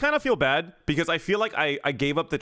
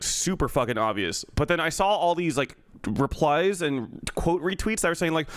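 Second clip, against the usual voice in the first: ordinary delivery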